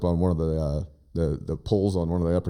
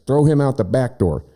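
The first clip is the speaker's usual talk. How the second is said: yell at son; 7 semitones higher than usual. Retold or imitated. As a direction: bark the order across the house. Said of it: "I yell at Jax".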